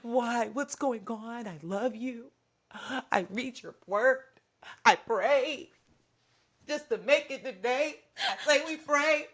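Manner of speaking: high, loud pleading to the Lord; hammed-up wail at God, slowing down; theatrical high plea to God; mock pleading prayer.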